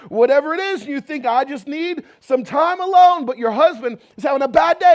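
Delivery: loud, high, ringing sermon delivery; full-force preaching. Acted. Preacher urging the congregation to say amen, loud and high. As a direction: preach it out to the back row.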